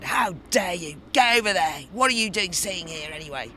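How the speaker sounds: loud, high, furious mock telling-off; retold angry shout. Burly Labour lords ordering her off their bench: loud angry shout. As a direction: bark it like an outraged burly lord. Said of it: "they said it out loud, really loud".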